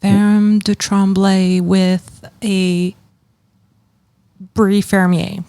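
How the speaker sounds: French names "Ferme de Tremblay" and "Brie Fermier" said slowly with an American r and flat vowels; slow, Americanized "Ferme de Tremblay", "Brie Fermier"; American accent. Shown as a demonstration. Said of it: "I can say it with an American accent".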